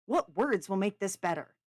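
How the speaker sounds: loud, exasperated question; heated, frustrated comeback. A narrator's reading. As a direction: demand an answer, at a loss. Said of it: "in frustration".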